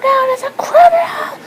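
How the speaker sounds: high, startled cry of alarm; alarmed shout. Pretend. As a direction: yell out in sudden panic. Child spots a criminal, alarmed.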